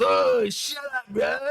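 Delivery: slow, high broad Australian drawl; lazy Aussie brush-off; Aussie bogan. Retold or imitated. Random Aussie player cutting in, slow broad Australian brush-off. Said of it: "this Aussie goes".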